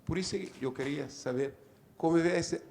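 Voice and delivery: Portuguese-accented Spanish; Spanish mixed with Portuguese words and sounds. Real, not acted. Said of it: "Me disculpe mi portuñol".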